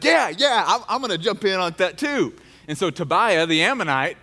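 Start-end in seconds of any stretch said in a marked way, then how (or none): 0.0-2.3 higher, eager tagalong voice; weak sidekick kid. Acted. The weaker kid joins the teasing, eager tagalong voice. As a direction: chime in like the weakest kid in the gang. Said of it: "one of the weaker kids chimes in".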